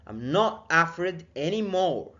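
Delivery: slow "I'm not afraid anymore" with NOT pushed up; stress on NOT. Shown as a demonstration. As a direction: hit NOT firmly. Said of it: "teacher models a phrase". (تشديد على not ببطء)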